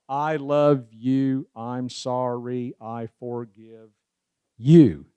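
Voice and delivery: very slow, spaced phrases marking the beat; ten count rhythm. Shown as a demonstration. Speaker counting the beats of the four phrases, slow. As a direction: say each phrase slowly in a steady beat. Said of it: "Ten counts".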